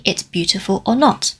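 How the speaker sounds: normal talking voice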